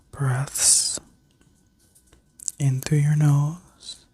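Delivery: sleep story narrator. Acted. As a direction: guide them gently into rest. Leads a guided healing meditation.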